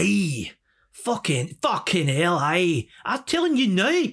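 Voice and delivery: thick Scottish, climbing very high; deep Scottish accent. Retold or imitated. Impersonating Frank from the bookies, thick Scottish accent. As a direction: ham up a broad Scottish rant. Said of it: "he was, like, deep Scottish".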